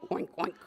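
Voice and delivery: low, short pig oinks; two quick grunted oinks; grunting. A narrator's reading. Storyteller showing children the pig's call, low grunting. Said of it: "The pig was grunting".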